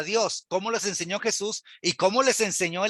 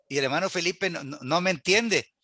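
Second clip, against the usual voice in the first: usual speaking voice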